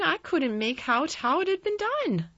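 put-on very high country-boy voice; Dickon crying out. A narrator's reading. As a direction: exclaim it high and eagerly. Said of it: "cried Dickon".

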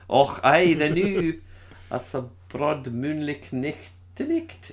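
hammed-up broad Scottish accent. Shown as a demonstration. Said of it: "everyone in Scotland sounds like that".

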